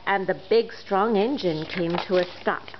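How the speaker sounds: plain reading voice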